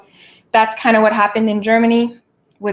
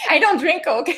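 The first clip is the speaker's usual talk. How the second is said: laughing through the words; 7 semitones higher than usual. Real, not acted. Mocking her own rant to viewers, laughing. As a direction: giggle the line, amused at herself.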